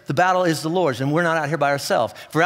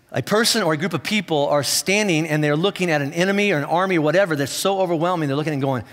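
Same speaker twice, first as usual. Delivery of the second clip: ordinary talk, nothing marked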